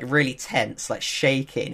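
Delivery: ordinary talk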